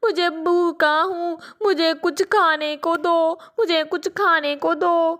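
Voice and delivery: a little crow faking grief for food; pleading child voice, pretend tears; fake crying orphan. Crow child begs parrot.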